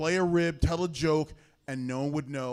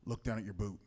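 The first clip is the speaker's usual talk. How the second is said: low, softer murmured prompt; sly aside; 5 semitones lower, 6 dB quieter than usual. Retold or imitated. Owen to his opponent mid-match, discreet aside. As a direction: slip it quietly to your opponent.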